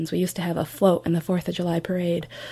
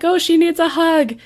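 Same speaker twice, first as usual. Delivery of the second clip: much higher, louder mock coo; cooing sympathy; 9 semitones higher, 6 dB louder than usual. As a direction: imitate friends fussing over her. Friends cooing over crying granddaughter.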